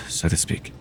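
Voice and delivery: breathy afterthought, barely voiced; whispered aside. Healer adds a private remark while probing the mind, whispered. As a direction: let it fall away into a whisper. Aside during energy assessment.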